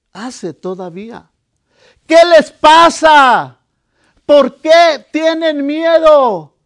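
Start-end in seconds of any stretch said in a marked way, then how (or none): 2.1-6.6 loud rebuke. Retold or imitated. Lord asking the same today.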